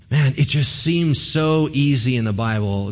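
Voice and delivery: ordinary delivery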